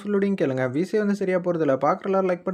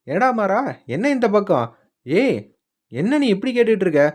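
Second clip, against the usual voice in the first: higher, louder mock-indignant yell; retold yelling; 4 semitones higher, 3 dB louder than usual. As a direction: act out an aunt yelling at her nephew. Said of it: "அப்படின்னு சொல்லி கத்துறாங்க". (கத்துவது போல நடித்து, உரத்த குரலில்)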